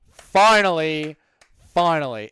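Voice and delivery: finally dragged out slow with relief; drawn out. Shown as a demonstration. Streamer reading chat, finally drawn out slowly.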